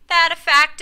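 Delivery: loud, high demanding challenge. A narrator's reading. Otis challenging Marjorie, loud demand. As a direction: snap the question back at her. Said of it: "demanded Otis".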